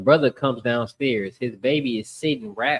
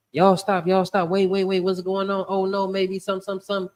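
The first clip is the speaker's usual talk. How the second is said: higher-pitched plea to break it up; lightly voiced worried mama; 7 semitones higher than usual. Mama runs downstairs to break up the brothers, lightly mimicked pleading. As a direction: step in as the mama stopping a fight.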